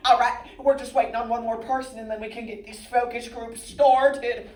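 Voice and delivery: high, loud corporate facilitator; brisk focus group leader. Acted. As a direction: take charge of the room, loud and high.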